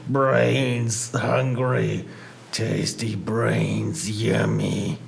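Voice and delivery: low, slow, groaning zombie. Pretend. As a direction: moan it like a hungry zombie. Reading zombie game title screen.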